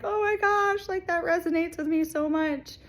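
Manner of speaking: high, choked-up voice breaking; overwhelmed, on the edge of tears; tearful. Retold or imitated. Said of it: "broke down, tears".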